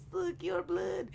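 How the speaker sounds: child's attempt at a Russian accent, high and thin; bad Russian accent. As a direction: imitate a kid doing a Russian accent. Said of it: "he tried to do my Russian accent".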